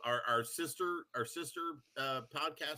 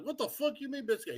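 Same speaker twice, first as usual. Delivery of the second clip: incredulous; 13 semitones higher than usual. Retold or imitated. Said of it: "I was like".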